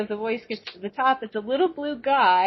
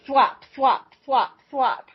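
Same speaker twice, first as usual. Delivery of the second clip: imitated thwop sounds; 3 semitones higher than usual. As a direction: pop out each thwop like a suction sound. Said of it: "it was just thwop".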